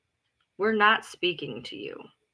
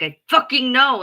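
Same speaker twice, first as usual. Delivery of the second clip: loud, higher insisting; insistent retort; 5 semitones higher, 7 dB louder than usual. Retold or imitated. Snapping back at people who doubt her, loud. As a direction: hit back hard and firm. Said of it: "And I'm like".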